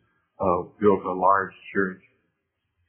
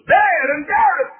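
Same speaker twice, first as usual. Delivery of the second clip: sudden preacher's shout; 6 dB louder than usual. Acted. Preacher retells hearing of a dead heathen God, sudden shout. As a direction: leap into a high, loud cry.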